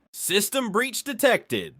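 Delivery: lightly voiced Russian-accented growl, slow; a hint of a menacing Russian announcer. A narrator's reading. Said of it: "the voice growls in English with a heavy accent".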